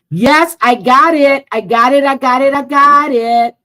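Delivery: crowing with delight, almost singing; excited cheering. Real, not acted. Streamer finds the email she waited for, loud joyful chant.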